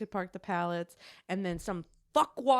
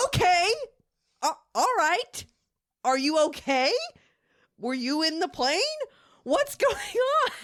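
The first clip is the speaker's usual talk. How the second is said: loud, higher confused retold questions breaking into a laugh; louder alarmed retelling of her own questions; loud bewildered questions; 8 semitones higher, 6 dB louder than usual. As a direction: ask it loud and baffled. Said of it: "And I'm like".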